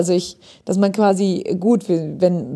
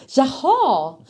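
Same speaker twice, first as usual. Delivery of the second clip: melodic Swedish; slower than usual. Shown as a demonstration. Showing a German co-host how Swedish sounds, melodic Swedish. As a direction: exaggerate the Swedish melody, pitch leaping. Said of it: "auf Schwedisch würdest du sagen so".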